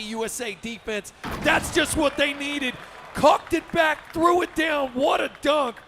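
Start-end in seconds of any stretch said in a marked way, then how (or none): 1.4-5.8 slow, high, punched-out exclamations; excited dunk call. Real, not acted. Commentator hails a big dunk, excited call. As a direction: hammer out each phrase of the dunk.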